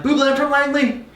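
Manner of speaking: high, eager shout-out to a star; excited fan greeting. Retold or imitated. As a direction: blurt it out like a starstruck fan. Greeting famous judge on stage.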